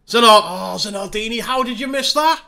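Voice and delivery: high-pitched cry of disbelief at a miss; exasperated disbelief. Real, not acted. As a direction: wail it at the striker, pitch climbing. Striker misses a chance in game.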